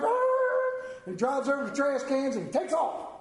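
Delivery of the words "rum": high, loud sung engine rev; engine roar. Retold or imitated. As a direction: roar it like a racing car. Imitating a car engine.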